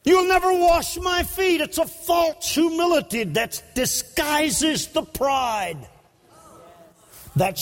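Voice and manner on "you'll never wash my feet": proud refusal. Acted. Preacher shouts Peter's proud refusal.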